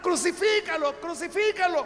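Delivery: high, loud chanted shout; crowd shouting. Retold or imitated. The crowd yells at Pilate, a loud high chant. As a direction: mob roaring for the cross.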